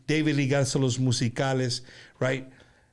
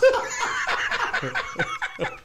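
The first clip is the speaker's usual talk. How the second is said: high laugh. Real, not acted. Laughing at own accent.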